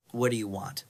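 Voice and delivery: quiet, trembling plea; frightened stammer. A narrator's reading. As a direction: stammer it out in fear. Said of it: "shaking as she stammers".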